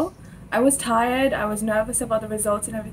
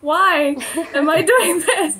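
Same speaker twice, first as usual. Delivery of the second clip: drawn-out, higher, louder mock wail; mock exasperation; 6 semitones higher, 5 dB louder, slower than usual. Student retelling complaints to God, mock exasperation. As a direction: lament it slowly with a laugh.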